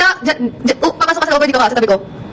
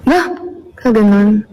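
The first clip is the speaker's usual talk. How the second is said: ordinary talk, nothing marked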